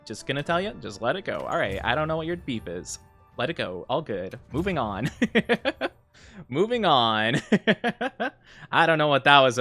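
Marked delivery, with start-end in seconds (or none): none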